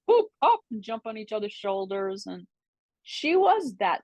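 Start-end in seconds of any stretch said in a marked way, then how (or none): 0.1-0.6 high, bright mock acrobat call; tumbler's whoop. Imitating a vaudeville tumbler jumping onto shoulders, high whoop. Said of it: "be like".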